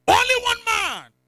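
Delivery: high, loud and slow proclamation; preaching shout. Acted. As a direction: ring it out slowly at full force. Preacher builds dramatic climax.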